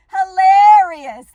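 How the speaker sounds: slow, loud laughing. Real, not acted. Host laughing loudly at the buffhead insult, drawn-out. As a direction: stretch the word out through laughter.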